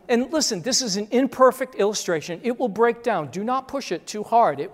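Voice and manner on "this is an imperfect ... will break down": normal talking voice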